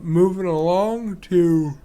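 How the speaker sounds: slow, yawned-out words; yawning. Real, not acted. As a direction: speak mid-yawn, drawn out. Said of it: "sorry about that".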